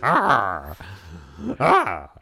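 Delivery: loud cackling mad laugh; maniacal laugh. Pretend. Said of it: "while we're maniacally laughing".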